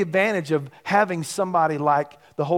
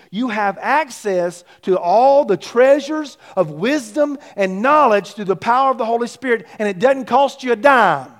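booming motivational speech; 6 semitones higher, 8 dB louder than usual. Real, not acted. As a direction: build it up, hammering every phrase. Preaching at full force.

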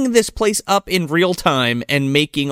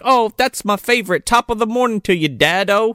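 high, lilting mock-Celtic accent; mock Celtic daughter; 6 semitones higher than usual. Daughter thanking her dad for the drink, high mock-Celtic accent. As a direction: chirp it in a put-on Celtic accent.

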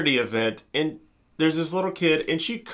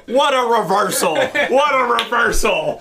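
loud, higher mock announcer exclamation; announcer call; 6 semitones higher, 5 dB louder, slower than usual. Commentator calling the move to the crowd, loud mock hype. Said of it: "The announcer's like".

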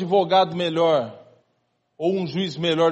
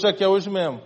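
ordinary talk

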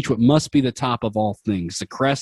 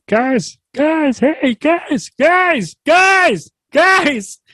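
very high, louder nagging little voice; nagging frog voice; 5 dB louder, much slower than usual. Acted. The frog nagging the heroes to wake up, squeaky. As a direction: pester them awake in a squeaky voice. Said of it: "nagging voice of life beckoning".

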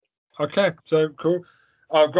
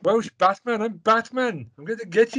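put-on high, sing-song Welsh accent; Welsh accent Batman; 8 semitones higher than usual. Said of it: "what accent is that?".

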